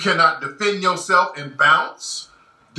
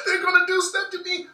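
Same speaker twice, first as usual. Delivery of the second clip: high, put-on frightened voice; mock victim whine; 12 semitones higher than usual. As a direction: pretend to be the scared victim. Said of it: "here they go".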